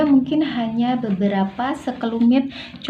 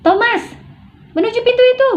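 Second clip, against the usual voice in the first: very high shouted order; angry yelling; 5 dB louder, faster than usual. Shown as a demonstration. Ordering Thomas toward the door, shouting angrily. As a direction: yell it out urgently, pitch right up. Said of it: "marah sambil berteriak".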